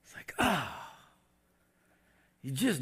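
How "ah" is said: high falling "ah" of dismay; regretful groan. Acted. Believer who did it anyway, dismayed groan. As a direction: let out an anguished sigh. Acting out regret after failing.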